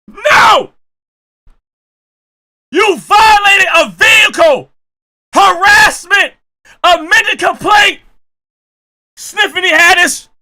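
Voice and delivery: loud, high hammed-up accusation; mock outrage. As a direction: yell charges like a furious prosecutor. Mock courtroom outrage rant.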